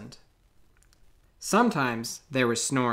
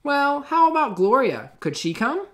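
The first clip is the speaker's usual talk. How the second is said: high, louder hopeful plea; Missus Topple pleading; 12 semitones higher, 5 dB louder than usual. Acted. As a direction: principal coaxing the officer. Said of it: "said Missus Topple".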